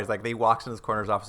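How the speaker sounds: ordinary talk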